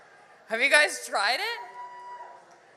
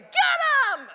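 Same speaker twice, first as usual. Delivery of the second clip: mock battle cry; 13 semitones higher, 5 dB louder than usual. Young her mocking old people.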